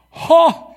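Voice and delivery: high loud jeering "Ha!"; mocking ha. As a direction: bark a scornful laugh at him. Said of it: "shouted abuse, shaking their heads in mockery".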